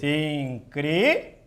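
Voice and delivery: Incríveis said slowly, syllable by syllable; lento, sílaba por sílaba; slow syllable split. Shown as a demonstration. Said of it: "Vamos separar as sílabas de incríveis".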